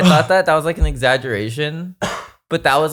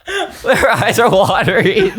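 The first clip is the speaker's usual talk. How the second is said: slow words through laughter; laughing it out; 7 semitones higher, 3 dB louder, slower than usual. Real, not acted. Laughing at a friend's reaction.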